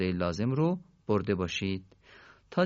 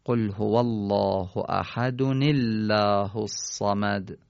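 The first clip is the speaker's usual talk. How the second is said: slow chanted recitation, verses joined; Quran recitation; much slower than usual. Shown as a demonstration. Teacher reciting Tawhid verses joined as a model, slow chant. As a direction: recite slowly and link احد into الله with a nun.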